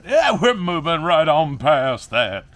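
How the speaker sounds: loud, high, slow drawn-out declaration; emphatic, hammed-up. Vlogger brushing off rotten plants, loudly hamming it up. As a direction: belt it out, stretching every word.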